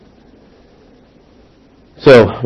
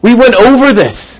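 exasperated; 10 semitones higher, slower than usual. Retold or imitated. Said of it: "but you just want to be like".

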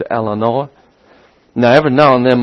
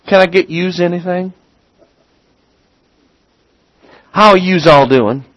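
higher, quick mock waitress asking about yous; imitating a French Canadian waitress; 7 semitones higher, 4 dB louder than usual. Preacher mimicking a northern waitress taking the order, light mock accent. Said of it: "the waitress may walk up to your group and say".

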